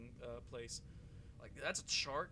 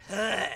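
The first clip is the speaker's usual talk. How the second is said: loud sharp gasping "Huh"; imitated gasp; 6 semitones higher, 18 dB louder than usual. Gamer imitating his own shocked gasp. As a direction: gasp it out like a startled breath. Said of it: "it's like everything goes".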